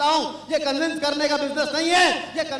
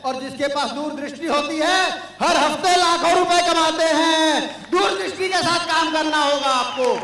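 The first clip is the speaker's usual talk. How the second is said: louder, driving to a shout; preaching shout; 3 semitones higher, 7 dB louder than usual. Real, not acted. Motivational speaker urging the audience to have vision, shouting. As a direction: hammer the lesson home.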